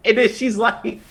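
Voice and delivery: real laughter breaking into the words; can't keep a straight face while retelling it; laughing through it. Real, not acted.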